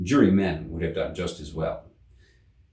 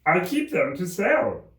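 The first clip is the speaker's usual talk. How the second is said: the Hatter; 10 semitones higher, slower than usual. A narrator's reading. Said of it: "the Hatter added as an explanation".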